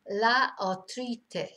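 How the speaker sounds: "La artritis" as la ar-TREE-tis, slowly; Spanish pronunciation. Shown as a demonstration. Teacher modelling the different word, "La artritis" as slow la ar-TREE-tis. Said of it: "pronounced completely differently".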